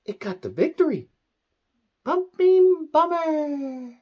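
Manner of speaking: high, very slow cooing pet voice; baby talk to cat. Acted. Telling his cat the winner in drawn-out baby talk. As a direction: stretch it out sweetly for the cat.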